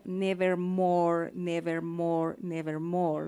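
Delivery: slow, drawn-out "nevermore" with a falling, heavy O; slow sad recitation of "nevermore"; mournful nevermore. Shown as a demonstration. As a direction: stretch each "nevermore" to weigh down the O. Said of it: "un peso emocional, una tristeza".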